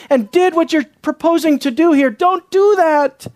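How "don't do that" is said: slow, high-pitched "Don't do that"; emphatic warning. Acted. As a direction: stretch each word of "Don't do that" hard. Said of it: "emphasizing it would be catastrophic".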